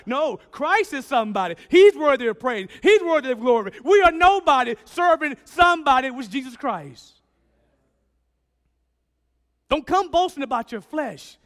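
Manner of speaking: loud, high, driving preacher's voice; full-force preaching. Acted. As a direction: preach it hard to the congregation.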